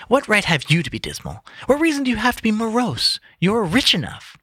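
high cheerful retort. Acted. Nephew teasing Scrooge cheerfully, high voice. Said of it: "returned the nephew gaily".

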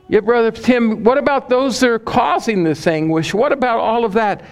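high-pitched voice of a challenging listener; questioning listener. Acted. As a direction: play a congregant pressing the preacher. Voicing a questioner to preacher.

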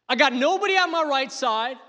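high, loud cry of abandonment; anguished crying out. Acted. Hunted David complaining to God, anguished cry. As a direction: cry it out noisily as a desperate plea. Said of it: "He's making noise, cry".

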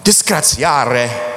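drawn-out Italian "disgraziare"; Italian grandparents. Retold or imitated. Preacher quoting Italian grandparents-in-law, slow Italian accent. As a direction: stretch it slowly with an Italian accent. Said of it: "Italian grandparents-in-law ... would always say".

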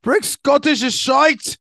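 loud, high, hammed-up Scottish accent; slow, high-pitched mock Scottish outburst; bad Scottish accent. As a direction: shout it in a terrible put-on Scottish brogue. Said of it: "Scottish accent".

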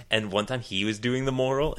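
ordinary talk, nothing marked

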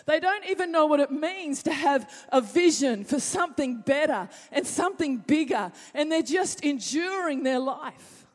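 high, loud preaching build; a preacher pressing the crowd, full force. Real, not acted. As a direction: drive it home from the pulpit.

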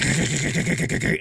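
rapid stuttering k-k-k motor noise; imitated sputtering engine. Storyteller mimicking a dying weed eater, imitated sputter. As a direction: make a motor choke and sputter.

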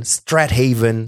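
Strathaven as strat-HAY-ven, slow and loud; incorrect Strathaven. Shown as a demonstration. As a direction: drag out Strathaven as "strat haven". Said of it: "it is completely incorrect".

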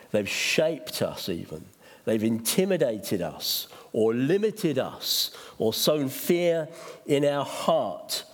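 ordinary delivery

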